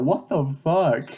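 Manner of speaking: normal talking voice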